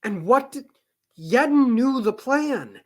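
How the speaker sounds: frustrated. Real, not acted. Frustrated outburst at Yedden.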